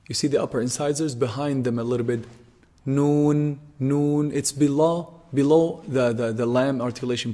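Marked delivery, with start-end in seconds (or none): 2.8-4.3 "Noon" held long, ringing in the nose; nasal noon. Shown as a demonstration. Teacher modelling the ghunna sound, long nasal "Noon". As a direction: hum out the n in "Noon" from the tongue tip. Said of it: "There's nasal sound".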